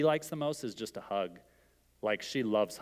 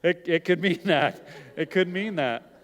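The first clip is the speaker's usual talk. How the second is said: laughing; 6 semitones higher, 7 dB louder than usual. Real, not acted. Laughs at audience answer.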